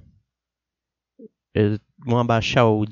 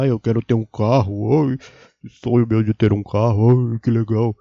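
mocking dreamy voice; 2 semitones lower, 4 dB louder than usual. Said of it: "Eu vejo os caras".

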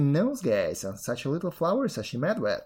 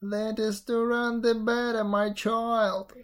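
raised voice of a wolf playing granny; disguised wolf; 7 semitones higher, slower than usual. A narrator's reading. Wolf as grandmother reassuring girl, raised false voice. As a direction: answer high and evenly, posing as grandmother.

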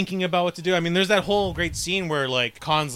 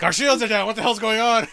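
mock panic; 8 semitones higher, 5 dB louder than usual. Captain panics in retold scene.

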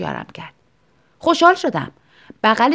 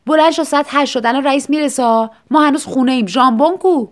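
loud, higher, hurried alarm; urgent wake-up; 4 semitones higher, 6 dB louder than usual. Acted. Wife wakes husband late for work, urgent and loud. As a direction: shake him awake with panic.

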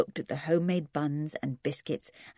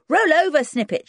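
high, loud command to the dog; shouted command; 11 semitones higher, 8 dB louder than usual. Acted. Twins shouting at their poodle, loud and high. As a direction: call the dog over sharply. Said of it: "shouted the Harrys".

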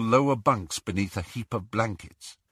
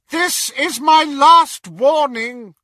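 loud, slow, high warning; threatening shout; 12 semitones higher, 11 dB louder, slower than usual. Acted. Intruder warning a mother shielding her son, threatening. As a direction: thunder it as a final threat.

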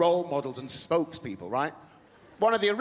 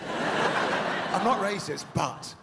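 slow knowing delivery; much slower than usual. Retold or imitated. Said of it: "they normally say it like this".

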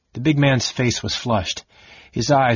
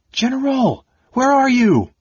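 much higher and louder, urgent call; shouting; 5 dB louder than usual. A narrator's reading. Soldiers searching for their general, urgent shout. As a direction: yell for the general. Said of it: "voices shouted".

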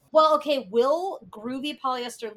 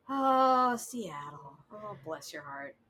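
mock pity; slower than usual. Mock pity for Seattle police.

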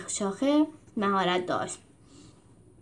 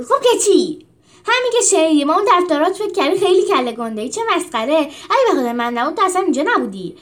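higher, louder, fast scolding; angry quarrel; 6 semitones higher, 7 dB louder than usual. Acted. Julius turning on Rufus in a loud angry row. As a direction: tear into him, furious.

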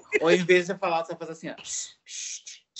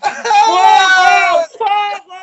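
high, loud cry of fire; shouting fire; 15 dB louder, much slower than usual. Retold or imitated. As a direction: scream fire for help. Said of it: "tu grita".

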